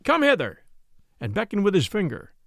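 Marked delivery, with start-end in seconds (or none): 0.0-0.6 higher, mild summoning call. A narrator's reading. Knight summoning his men-at-arms, calling out. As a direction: call them over in a raised pitch. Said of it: "he called".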